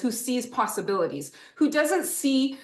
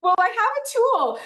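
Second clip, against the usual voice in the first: bright burst of enthusiasm; excited; 12 semitones higher, 5 dB louder than usual. Real, not acted. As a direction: announce it with eager delight. Excited reveal of teaching tool.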